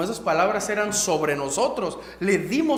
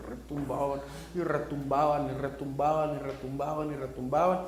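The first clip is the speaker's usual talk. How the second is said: echoing repetition; 7 semitones lower, 8 dB quieter than usual. Shown as a demonstration. Doctor's words echoing.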